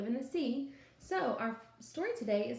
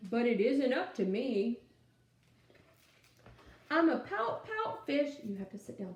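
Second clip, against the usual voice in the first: lively storytime read-aloud; animated picture-book reading; reading to children; 3 semitones higher, 3 dB louder, slower than usual. Acted. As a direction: read it out to a class of little kids.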